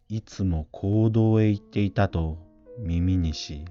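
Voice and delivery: usual speaking voice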